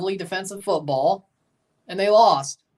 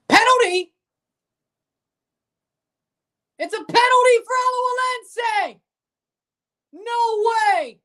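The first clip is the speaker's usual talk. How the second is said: high, loud stunned outburst; shocked shout; 14 semitones higher, 5 dB louder than usual. Real, not acted. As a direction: yell in disbelief at the call. Sudden penalty during live match.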